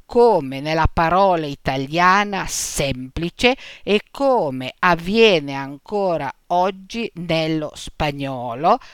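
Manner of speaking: ordinary delivery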